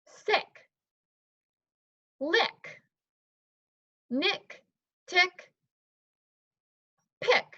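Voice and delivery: high, careful one-word-at-a-time reading; student reading aloud. Pretend. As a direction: read each word alone like a young pupil. Teacher plays student reading word list.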